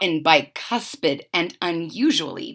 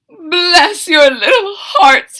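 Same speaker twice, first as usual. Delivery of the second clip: high, slow, sobbing plea; crying fox; 9 semitones higher, 8 dB louder, slower than usual. Acted. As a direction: beg through fake tears. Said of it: "he cried".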